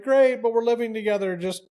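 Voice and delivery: sarcastic comeback to the couple, pitched high; sarcastic retort. Retold or imitated. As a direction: fire back with put-on high cheer. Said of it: "You're like".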